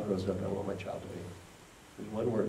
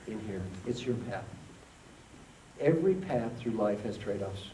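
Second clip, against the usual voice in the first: usual speaking voice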